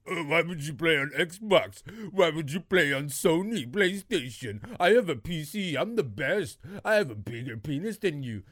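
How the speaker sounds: lightly voiced smug gamer, a touch of a sneer; mock the bragging gamers with a hint of a put-on voice; imitating a boastful PC gamer, lightly voiced. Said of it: "you've been touting the horns of this P C shit".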